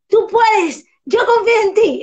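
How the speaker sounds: loud, high cheer of encouragement; rally the child with belief; encouraging. Shown as a demonstration. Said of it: "Mi lenguaje es". (ánimo firme a un niño, agudo y fuerte)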